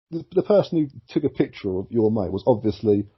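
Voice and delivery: ordinary talk, nothing marked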